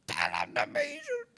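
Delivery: high, slow, mock-tearful cry; strained prayer. Praying through his toothache, high strained wail. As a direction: squeeze out the prayer as if in agony. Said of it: "saya doa dulu".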